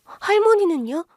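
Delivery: put-on high, small child's voice asking in surprise; a young girl, wide-eyed, asking for grandma; girl asks the couple in a high, startled child voice; startled little girl. A narrator's reading. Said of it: "놀란 눈으로 물었어요".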